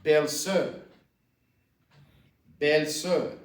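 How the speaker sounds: "belle-sœur" said bel-SUR; careful French "belle-sœur"; pronunciation model. Shown as a demonstration. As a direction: sound out "belle-sœur" for learners. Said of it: "is pronounced".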